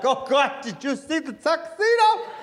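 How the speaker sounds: put-on high, slow gushing old lady; old lady at the wedding gushing, high and slow; weepy old lady. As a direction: swoon over it like a teary grandma. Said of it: "some old lady in the back".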